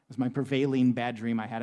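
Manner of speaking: ordinary talk, nothing marked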